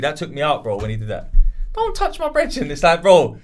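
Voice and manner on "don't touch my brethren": high, mock protective line; high mock Justo. Friend mimicking the host defending his mate, high-pitched. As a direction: squeak it like an overprotective friend. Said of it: "That, that killed me".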